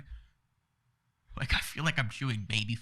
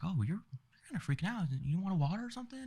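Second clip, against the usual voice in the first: high-voiced imitation of a nurse; concerned nurse; 8 semitones higher than usual. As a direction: pitch it up as the nurse checking on a patient. Retold nurse checking on him.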